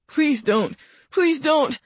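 rising frantic begging; frantic pleading. A narrator's reading. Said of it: "in a progressively more frantic voice".

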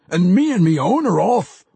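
a wild battle rager huffs he's leaving; huffing dwarf. A narrator's reading. Pwent huffs to Bitterdew that his band is off, gruff and loud. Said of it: "the wild battle rager huffed".

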